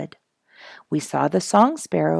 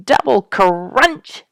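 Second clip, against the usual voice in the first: slow, higher and louder imitated crunch; 5 semitones higher, 6 dB louder, much slower than usual. A narrator's reading. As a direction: push the crunch up and out. Porcupines chewing, repeated.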